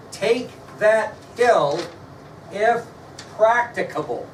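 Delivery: slow, weighty reading of Lee's order; quoted order. Shown as a demonstration. Teacher reading a general's order to the class, slow and weighty. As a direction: read the order slowly, each word weighed. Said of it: "Listen to this carefully".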